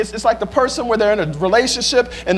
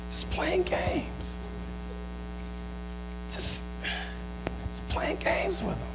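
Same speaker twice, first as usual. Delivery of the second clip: much softer, nearly whispered aside; hushed lament; 4 semitones higher, 17 dB quieter, slower than usual. Acted. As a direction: let the words sink almost to a whisper. Preacher drops to a hush.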